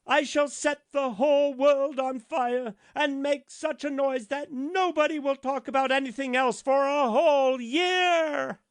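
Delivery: high, loud, swelling grander line by line; rising boast. A narrator's reading. The rocket boasting as he climbs, loud and soaring.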